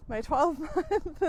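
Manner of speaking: retry the phrase through a laugh. Real, not acted. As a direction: laugh breaks into the words. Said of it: "God, I'm out of breath today".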